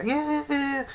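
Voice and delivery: high mocking yeah yeah yeah; dismissive. Real, not acted. Brushing off complaints, high mocking dismissal. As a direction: brush them off with a sneer.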